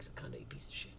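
unvoiced whisper, very soft; whispered insult. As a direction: breathe the insult. Muttered joke about bully.

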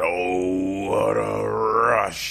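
long, drawn-out wrestler catchphrase; Legion of Doom. Retold or imitated. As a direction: stretch the last word right out like a wrestler. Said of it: "the Legion of Doom".

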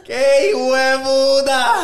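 high, loud, drawn-out cry; real high-pitched shouted reaction; outraged amused yell. Real, not acted. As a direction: yell it out at the punchline. Yelled reaction to a punchline.

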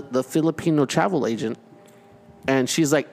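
normal talking voice

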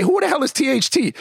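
usual speaking voice

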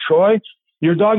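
high, loud call across the hill; retold yell. Yelling a warning at the suspect about his dog. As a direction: shout the name, warn him. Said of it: "I'm yelling at the guy".

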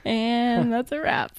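slow, stretched wrap-up line; playful slow sign-off; drawn-out sign-off. Pretend. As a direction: draw it out, lifting at the end.

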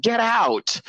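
high-pitched pretend kick-out; mock outburst. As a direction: play at throwing someone out, pitch jumping up. Imagined ordering someone out of bed.

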